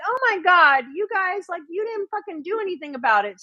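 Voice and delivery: a touch of an exasperated rant, sped up at the end; exasperated retort. Retold or imitated. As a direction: vent at them in disbelief. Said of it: "I'm like".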